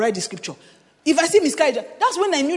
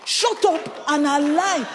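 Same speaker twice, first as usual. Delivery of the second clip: slow emphatic; 6 semitones higher, 3 dB louder, much slower than usual. Shown as a demonstration. To the congregation, a loud slow shut up and align. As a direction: say shut up and align louder, dragged out slowly. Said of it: "Anything your pastor has declared".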